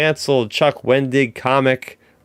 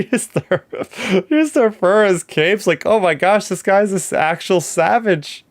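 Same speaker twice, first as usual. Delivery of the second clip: higher, half-laughing outrage; excited disbelief; 8 semitones higher than usual. Real, not acted. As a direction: pitch up, amazed at how savage he is. Outraged at the villain's cruelty.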